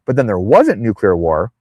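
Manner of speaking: usual speaking voice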